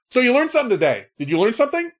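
high mock schoolteacher tone; mocking quote. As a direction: needle him in a high sing-song. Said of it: "He's like".

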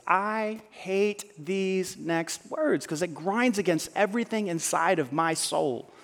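slow, drawn-out words; slow reluctant. Shown as a demonstration. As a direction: drag each word as if it hurts to say.

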